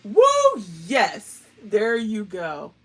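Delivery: loud cheering whoop; big excited yell of triumph. Real, not acted. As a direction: celebrate a win, loud. Cheering success.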